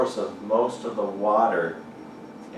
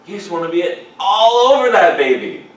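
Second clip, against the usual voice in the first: excited preaching; 11 semitones higher, 10 dB louder than usual. Real, not acted.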